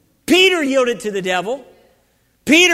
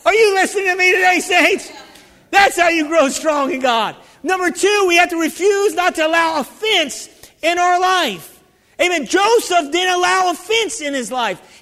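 full-force preaching; 4 semitones higher than usual. Acted. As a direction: drive every line home to the saints.

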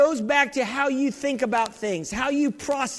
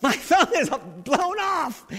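higher, mock-angry retort; mad chieftain; 6 semitones higher, slower than usual. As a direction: protest in mild mock outrage, drawing out the end. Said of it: "the chieftain got mad".

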